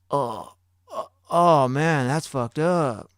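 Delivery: halting oh, then slow, put-on sympathy; weird guilty reaction. Backseat rider reacts to the egged car, weirdly slow feigned dismay. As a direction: imitate a guilty guy faking dismay, drawn out. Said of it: "really weirdly".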